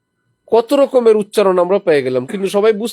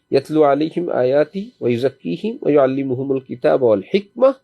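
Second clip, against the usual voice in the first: Quran recitation; 4 semitones lower than usual. Shown as a demonstration. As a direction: chant the verse as in prayer recitation. Recites verse with tajweed.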